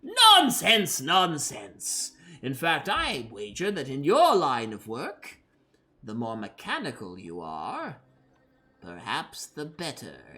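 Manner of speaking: mad scientist voice. Acted. Doctor continues persuading.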